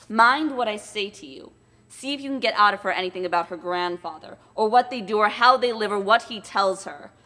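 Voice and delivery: loud, bullying husband giving orders; Quilp's harsh commands; menacing dwarf. Acted. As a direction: bark orders at a timid wife. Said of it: "gives his timid wife ... the following instructions".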